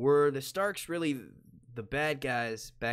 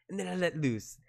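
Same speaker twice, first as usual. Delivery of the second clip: higher comic voice, falling away; movie host impression; 4 semitones higher than usual. Host demonstrating his exercises, releasing comic voice. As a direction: let the tension drop out, pitch sliding down. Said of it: "And he goes".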